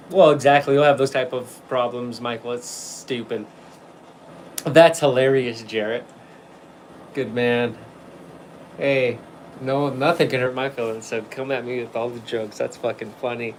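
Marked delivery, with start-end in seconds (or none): none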